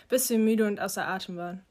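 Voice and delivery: plain reading voice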